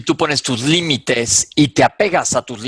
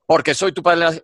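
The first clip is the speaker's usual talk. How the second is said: higher, louder mock father's retort; imitating angry father; 6 semitones higher, 4 dB louder, slower than usual. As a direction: play the offended dad.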